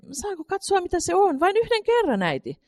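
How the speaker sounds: put-on high, pleading child's voice; begging little girl. A narrator's reading. Katri begs mother for one look, high pleading child. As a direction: wheedle for just one look.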